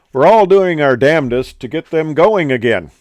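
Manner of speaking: hammed-up British PA announcement; British captain accent. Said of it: "my best, uh, British, uh, accent".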